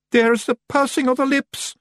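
very high Scots cry of alarm; hammed-up high alarm; panicked shout. A narrator's reading. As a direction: cry out in dread as a wee Scotsman. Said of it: "a Feagle shouted".

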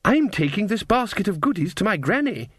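put-on high, innocent child voice; sweet little girl. A narrator's reading.

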